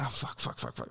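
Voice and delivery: muttered swearing under the breath; hushed panic. Retold or imitated. Man realizing his girlfriend is upset, hushed alarm. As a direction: imitate dawning dread, very soft and breathy. Retold sudden dread at her upset.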